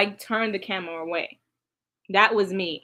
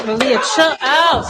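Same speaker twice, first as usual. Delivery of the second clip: loud, higher call to back off; yelling to calm her; 4 semitones higher, 8 dB louder than usual. Real, not acted. Yelling at Malia to stop the fight, loud and urgent. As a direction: shout at her to chill out.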